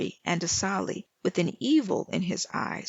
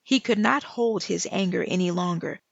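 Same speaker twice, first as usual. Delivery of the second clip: ordinary delivery, nothing marked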